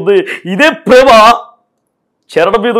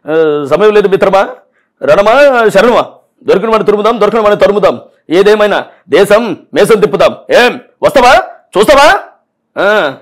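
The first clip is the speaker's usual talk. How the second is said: punch dialogue impression. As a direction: bellow the film hero's threats. Mimic performs Balakrishna-style film dialogues.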